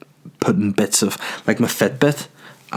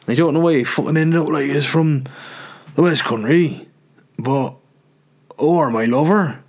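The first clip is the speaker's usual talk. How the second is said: raised-pitch West Country caricature; mock West Country accent; 5 semitones higher than usual. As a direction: put on a hammed-up West Country voice. Mock West Country reality-show voice.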